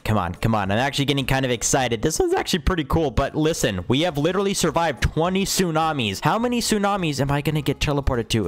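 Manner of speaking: ordinary talk, nothing marked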